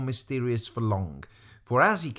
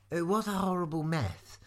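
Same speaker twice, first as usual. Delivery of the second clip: put-on dismayed girl; 5 semitones higher, 4 dB quieter than usual. A narrator's reading. Lavender facing even more trouble, high dismayed voice. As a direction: say it small and pitched up.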